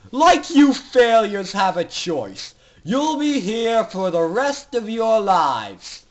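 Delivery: mock the prisoners with cruel pleasure; sneering villain doctor. Doctor Atmosphere sneering at the captive foals, loud and cruel.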